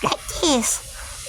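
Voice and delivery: high, soft, slow and half-whispered; high pitched voice. Shown as a demonstration. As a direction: try a childhood high voice, slowly. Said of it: "you know, high pitched".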